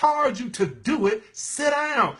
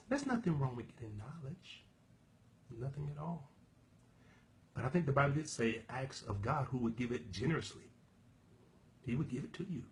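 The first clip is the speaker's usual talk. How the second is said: hushed preaching; 8 semitones lower, 15 dB quieter than usual. Real, not acted. Preacher dropping to a hush.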